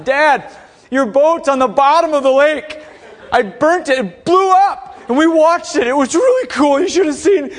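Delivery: loud, high, mock-enthusiastic reporting; hammed-up gleeful announcement to dad; excited phone call. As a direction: pitch bad news as thrilling. Imagined excited phone call to father.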